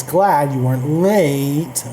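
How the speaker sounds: "glad" and "late" stressed and stretched out; emphasis. Shown as a demonstration. Reading the italicized example to learners, heavy emphasis on "glad" and "late". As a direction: slowly lean on "glad" and draw out "late". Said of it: "and then emphasis".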